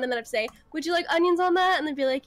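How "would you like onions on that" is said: higher-pitched drive-thru order taker; customer-service voice. Shown as a demonstration. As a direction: ask it in a raised, nice service voice. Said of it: "this is me in In-N-Out mode".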